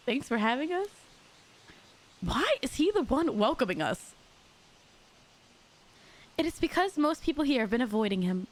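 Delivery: usual speaking voice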